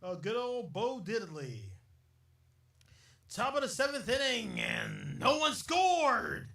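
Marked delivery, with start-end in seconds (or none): 3.3-6.5 loud, high mock play-by-play call; sports announcer. Podcaster playing ballgame announcer, loud mock call. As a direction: boom it out like a ballpark announcer.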